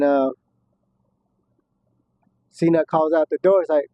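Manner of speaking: ordinary talk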